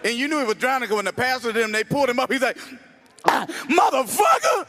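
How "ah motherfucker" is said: gasping outburst. Retold or imitated. Drowning man gasps out.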